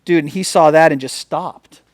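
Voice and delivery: ordinary talk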